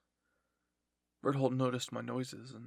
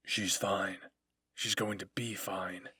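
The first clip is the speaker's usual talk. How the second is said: lower-pitched, firm soldier's voice; gruff dismissive soldier; 5 semitones lower, 3 dB louder, slower than usual. A narrator's reading. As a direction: brush off the worry, low and firm. Reiner answers his worried comrade.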